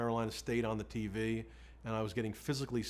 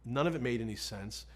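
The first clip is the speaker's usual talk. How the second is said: ordinary talk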